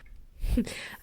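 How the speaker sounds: a short quiet giggle; soft laugh. Real, not acted. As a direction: laugh softly to yourself. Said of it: "I can't stop laughing from this".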